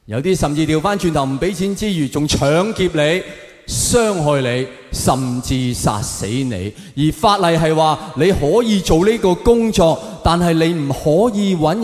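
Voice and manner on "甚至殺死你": ordinary talk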